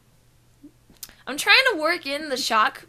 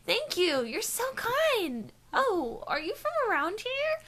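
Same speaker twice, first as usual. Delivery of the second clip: high, softer, slow gushing woman; 10 semitones higher, 4 dB quieter, slower than usual. Acted. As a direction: coo it high at a new acquaintance. Voicing the woman they meet.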